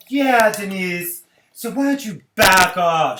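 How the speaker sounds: loud, high, slow mock shout; drawn-out shouted back off; hammed-up Camille telling Denise off; mock Camille yelling. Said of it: "Camille was like".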